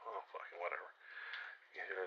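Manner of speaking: ordinary talk, nothing marked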